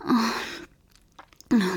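ordinary talk, nothing marked

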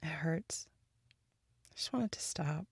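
soft, pained whisper; hurting whisper. Acted. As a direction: barely voice the hurt. Admitting pain quietly.

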